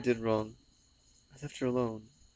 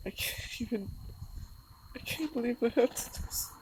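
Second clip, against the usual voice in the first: high, cracking voice that breaks into whisper; sobbing words that keep breaking off; crying hard; 10 semitones higher than usual. Real, not acted. Crying, dreading wrapping her pet.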